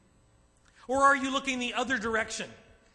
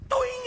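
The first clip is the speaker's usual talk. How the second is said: high, loud boing as the dog bolts; cartoon dog zooming off; mock dog take-off; 6 dB louder than usual. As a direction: launch it like a spring. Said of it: "this dog just goes".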